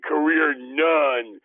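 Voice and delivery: slow Arkansas drawl on "Career none". Retold or imitated. Retelling Roy's words to a friend, slow Arkansas drawl. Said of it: "like he was from Arkansas".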